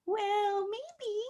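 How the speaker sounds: high mischievous whisper; whispered aside. Retold or imitated. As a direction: breathe it like a naughty secret. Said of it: "I'm kind of like".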